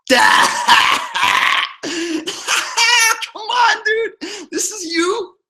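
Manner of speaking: fake-sob a wounded plea; mock crying wail. Mock wounded pleading to Eric.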